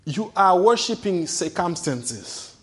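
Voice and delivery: usual speaking voice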